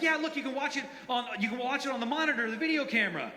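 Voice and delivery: high, excited offer; high-pitched boyish eagerness; eager boys. Retold or imitated. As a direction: imitate teenagers keen to show off.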